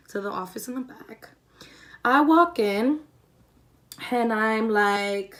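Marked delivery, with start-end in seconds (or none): 0.1-1.3 hushed unvoiced whisper. Storyteller whispers where she was sent, hushed whisper. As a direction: drop to a whisper for the aside.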